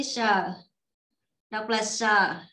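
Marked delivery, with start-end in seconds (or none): 1.8-2.4 "sờ" with a tense, tightened initial consonant; taut, stressed "sờ" for a doubled letter. Shown as a demonstration. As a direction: tighten the first sound of "sờ". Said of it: "đọc căng".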